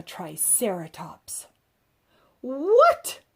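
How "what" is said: high, loud startled cry; shocked mother's outburst; a mother's alarmed exclamation; cried out. A narrator's reading. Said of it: "cried my mom".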